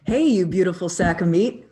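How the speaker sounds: loud, punchy greeting to the viewer; loud hype call. Acted. Host hyping up the viewer, loud and punchy.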